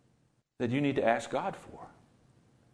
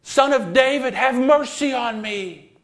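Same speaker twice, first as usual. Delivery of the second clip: crying out much higher and louder, like a drowning man; desperate cry; 12 semitones higher, 9 dB louder than usual. Retold or imitated. Blind man cries to Jesus, a desperate, loud plea. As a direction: yell like your life depends on it. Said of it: "he called out".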